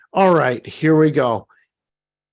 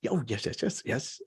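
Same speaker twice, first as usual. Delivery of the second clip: near whisper; 5 semitones lower, 14 dB quieter than usual. Real, not acted. Moved by the comments.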